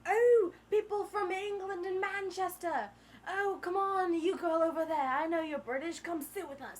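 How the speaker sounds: booming British butler, easing off at the end. Retold or imitated. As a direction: call out like a hammed-up butler. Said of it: "The butler was just like".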